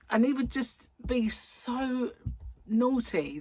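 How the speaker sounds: ordinary delivery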